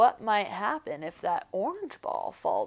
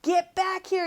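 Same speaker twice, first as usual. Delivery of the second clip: yelling; 9 semitones higher than usual. A narrator's reading. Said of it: "yelled Pipsqueak".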